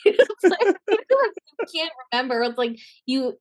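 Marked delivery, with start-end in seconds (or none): none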